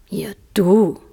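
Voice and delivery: scornful, brushing-off reply; dismissive caterpillar. Acted. Caterpillar to Alice, lightly dismissive. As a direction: toss it aside. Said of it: "sagte die Raupe wegwerfend".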